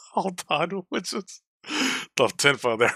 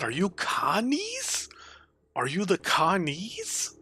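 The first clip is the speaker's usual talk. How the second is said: amazed whisper; 9 semitones higher, 3 dB quieter, slower than usual. Real, not acted. Reacting in amazement to game.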